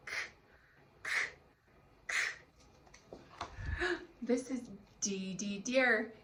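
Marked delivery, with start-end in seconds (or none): 0.1-2.2 "k" as a voiceless click of sound, not the letter name; three separate short "k" bursts; letter-sound demo. Shown as a demonstration. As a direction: say only the hard C sound "k", pausing between. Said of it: "C Katina Cat says".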